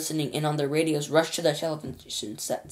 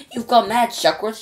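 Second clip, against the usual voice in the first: loud, higher angry shout; 7 semitones higher, 7 dB louder than usual. Acted. Ground control shouting at Shuckworth, angry. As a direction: shout him down. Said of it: "shouted ground control".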